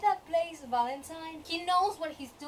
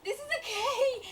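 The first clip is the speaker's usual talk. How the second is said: mocking Sebastian; 10 semitones higher than usual. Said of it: "Sebastian's like".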